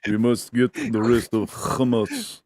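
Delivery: put-on slow, stiff Israeli accent with a guttural h in Hamas; slow Israeli accent, deliberate and solemn; mock Israeli accent. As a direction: play a hawkish official, Israeli accent. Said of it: "a Israeli accent is so funny".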